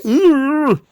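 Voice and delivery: high, loud groan of disgust; exasperated groan. Retold or imitated. Groaning reaction to boring promo.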